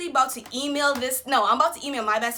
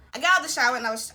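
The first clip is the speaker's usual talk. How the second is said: ordinary talk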